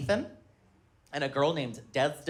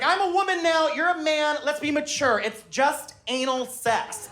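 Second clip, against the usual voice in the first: imitating his wife; 10 semitones higher than usual. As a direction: play her as calm and mature. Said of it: "She's like".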